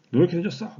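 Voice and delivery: high, loud mom scolding; scolding mother. Retold or imitated. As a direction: bark it at the late child. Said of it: "엄마가 막". (늦은 아이를 높고 큰 소리로 나무라는 엄마)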